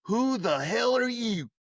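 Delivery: slow, high West Virginia drawl; a sharp West Virginian challenge to a stranger; West Virginian accent. Retold or imitated. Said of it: "with his West Virginian accent".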